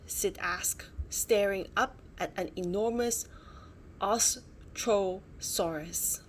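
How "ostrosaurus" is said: slow, grand naming; drawn out name. Acted. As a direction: stretch the big name out. Naming huge dinosaur.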